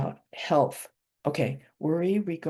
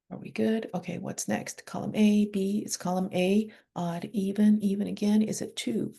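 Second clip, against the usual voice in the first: ordinary delivery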